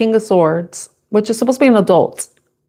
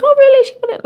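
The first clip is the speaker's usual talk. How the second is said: mother's mock surprise. Mother reacting to her son's story, hammed-up high surprise. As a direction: ham up a shocked mother, then a hush. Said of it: "the mother will be like".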